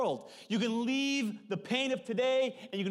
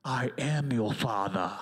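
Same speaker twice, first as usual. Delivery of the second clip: deep, slow, loud booming reveal; hammed-up villain voice with a laugh breaking through; Vader reveal; 10 semitones lower, 3 dB louder, slower than usual. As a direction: drop the bombshell slowly from low in the chest. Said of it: "He goes".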